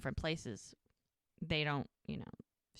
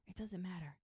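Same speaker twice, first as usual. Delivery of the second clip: much softer repeat, settling things; hushed dismissal; 10 dB quieter than usual. Retold or imitated. Lily the peacemaker smoothing things over, quietly dismissive. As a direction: let it drop away quietly.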